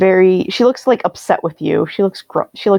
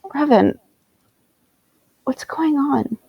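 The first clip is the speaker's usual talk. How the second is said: soft, higher-pitched appeal; quiet pleading; 5 semitones higher, 4 dB quieter, slower than usual. Acted. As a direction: ask him gently, upset. Acted plea for explanation.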